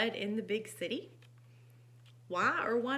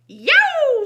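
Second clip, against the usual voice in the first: high, loud drawn-out cry; hammed-up storybook ouch; pained yelp; 20 dB louder than usual. A narrator's reading. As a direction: yelp as if bitten on the tail. Turtle bites pig's tail.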